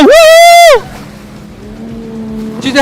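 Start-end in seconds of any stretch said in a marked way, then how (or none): 0.0-0.8 high shouted woo; loud woo. Shown as a demonstration. Host demonstrating the loud woo for the fan. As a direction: whoop loudly to show her. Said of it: "I need you to say hello".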